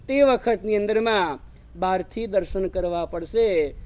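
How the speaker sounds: ordinary delivery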